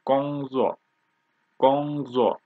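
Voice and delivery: Gongzuo with level Gong and falling zuo; first and fourth tone. Shown as a demonstration. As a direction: keep Gong level, let zuo drop. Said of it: "First tone and fourth tone".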